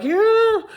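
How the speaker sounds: high, loud squeal of stress. Retold or imitated. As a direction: let out a tense, high cry. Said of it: "it was stressful 'cause I was like".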